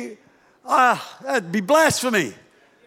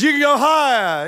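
higher-pitched, encouraging dad impression; raised-pitch fatherly encouragement; egging-on father; 7 semitones higher, 4 dB louder than usual. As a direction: play a dad urging the child on. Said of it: "the father pushes the swing, says".

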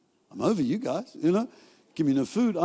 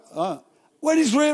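usual speaking voice

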